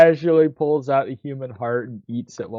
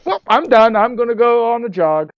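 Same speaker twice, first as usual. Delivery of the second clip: high mock voice; 10 semitones higher, 5 dB louder than usual. Voicing the lyricist going for a jog.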